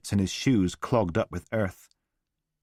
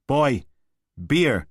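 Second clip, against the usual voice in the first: loud, higher barked command; barked order; 6 semitones higher, 6 dB louder than usual. A narrator's reading. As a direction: bark it at the boy. Father barking order at boy.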